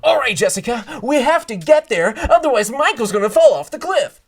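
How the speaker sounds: loud, high action-movie line; overacted action scene. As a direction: shout it like a blockbuster hero. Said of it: "the action is like".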